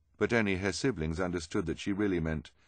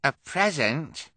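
high, loud, drawn-out question; astonished character voice; surprised cry; 9 semitones higher, 7 dB louder, slower than usual. Acted. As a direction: cry it out in delighted disbelief. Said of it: "Kevin cried".

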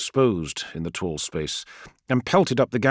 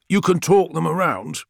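higher, louder incredulous challenge; interrupting; 8 semitones higher, 5 dB louder than usual. A narrator's reading. Friedish interrupts Call's plan to talk, challenging. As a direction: cut in sharply on the other speaker. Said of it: "interrupted Friedish".

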